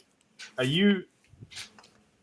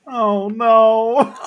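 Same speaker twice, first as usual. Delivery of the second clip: loud high dismayed oh no; loud high horrified groan; shocked cry; 12 semitones higher, 12 dB louder than usual. Acted. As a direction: cry it out in alarm. Player reacts in shock.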